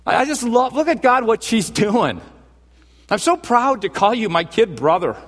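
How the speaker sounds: very high, thrilled and doting; proud big brother. Acted. Jesus showing God what his siblings are doing, thrilled and proud. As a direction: boast lovingly in a high, lifted voice. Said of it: "Jesus just goes".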